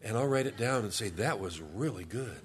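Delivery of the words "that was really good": laughing. Retold or imitated.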